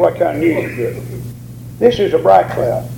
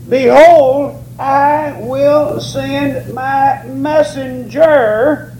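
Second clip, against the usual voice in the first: slow, raised, measured preacher's reading; scripture reading; 5 semitones higher, slower than usual. Acted. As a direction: read the prophecy out solemnly, word by word at first. Reads scripture aloud to congregation.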